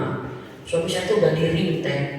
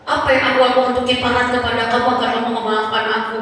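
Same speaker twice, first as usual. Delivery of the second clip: pleading husband; 6 semitones higher than usual. Retold or imitated. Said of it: "Dia kalau ketemu saya".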